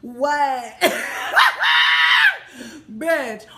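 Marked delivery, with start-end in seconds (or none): 0.8-2.9 loud, high screeching cackle; shrieking laugh. Real, not acted. Cracking up about hiding behind a dish rag, shrieking laugh. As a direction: erupt in a piercing laugh.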